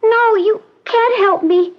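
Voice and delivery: put-on high, small voice of a withdrawn young woman; frightened girl. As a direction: push help away like a scared child. Patient refuses help, high voice.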